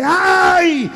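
high, drawn-out cries of burning clay; hammed-up pained yelling, high and slow; acted screaming. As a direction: wail in protest at the heat, pitched high. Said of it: "comienza a gritar y dice".

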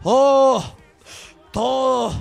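ordinary talk, nothing marked

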